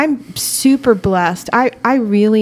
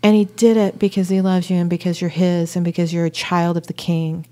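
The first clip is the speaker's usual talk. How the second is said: ordinary talk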